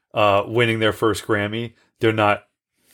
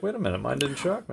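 mock surprise; 3 semitones higher, 9 dB quieter, faster than usual. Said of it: "were like".

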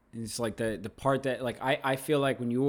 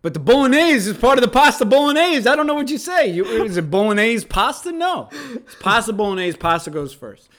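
higher and louder, getting worked up; excited rant; 8 semitones higher, 9 dB louder than usual. Real, not acted. Arguing food order with his cohost, rising excitement. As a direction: argue it hotly, voice climbing. Heated rant about dish naming.

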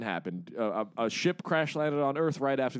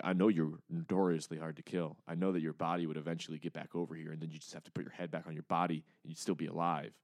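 low, quiet, quick menace; menacing Drax; 6 semitones lower, 7 dB quieter than usual. Retold or imitated. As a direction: threaten him softly, close and low. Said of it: "Drax picks the head up and is like".

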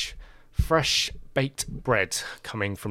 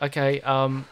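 rising, louder bye; hasty goodbye; 5 semitones higher, 5 dB louder, slower than usual. Acted. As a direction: rush off out the door. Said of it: "he's like".

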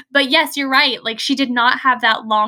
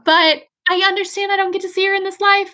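higher, tender voicing of a dying mother; tearful farewell; 7 semitones higher, faster than usual. Friend voicing dying Isabel handing over her letter, higher, tender. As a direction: let her say goodbye, lightly near tears.

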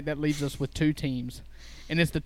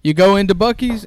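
loud insistent defense; 3 semitones higher, 9 dB louder than usual. Real, not acted. Defending himself, heated.

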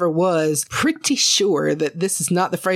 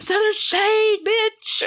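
much higher, sassy hype call; brash shout-out; 13 semitones higher, much slower than usual. Pretend. Hyping the podcast to strangers with sass. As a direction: fling the name out with swagger.

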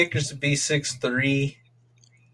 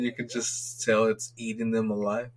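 normal talking voice

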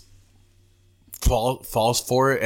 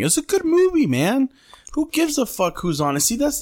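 excited; 14 semitones higher than usual. Real, not acted. Enthusiastic movie praise.

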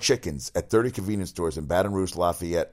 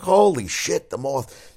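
high, loud startled yelp that falls away; shocked exclamation; 8 semitones higher, 7 dB louder, slower than usual. Retold or imitated. Host retelling his reaction to the moth in the ear, startled. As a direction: blurt it out in disbelief. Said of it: "I was like".

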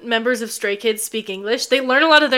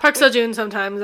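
ordinary talk

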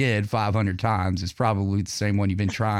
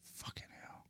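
whispering; 29 dB quieter than usual. Real, not acted. Cursing under breath at broken setup.

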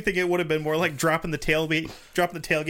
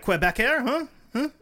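put-on Quebec accent; 7 semitones higher than usual. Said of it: "what Canadian accent to use".

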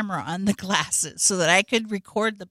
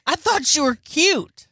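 mock outrage; 6 semitones higher, 4 dB louder than usual. Mister Hanky scolding Vanessa in a high indignant yell. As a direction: blast Vanessa like a betrayed cartoon. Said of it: "He's like".

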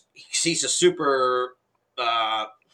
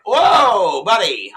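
loud, high whoa to back him off; startled shout; 6 semitones higher, 7 dB louder, much slower than usual. Retold or imitated. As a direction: throw up your hands and shout him down. Startled reply to aggressive guy.

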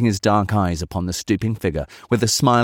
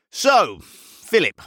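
raised, much higher address; 11 semitones higher, much slower than usual. A narrator's reading. Chemist breaks off musing to address old man, voice raised. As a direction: break off and call over to him. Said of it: "raising his voice as he addressed the old man".

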